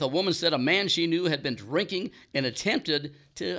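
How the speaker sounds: usual speaking voice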